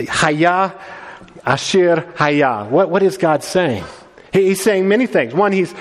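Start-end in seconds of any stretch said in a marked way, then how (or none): 0.1-2.7 Haya Asher Haya spoken slowly in Hebrew; Hebrew phrase. Shown as a demonstration. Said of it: "Uh, yeah".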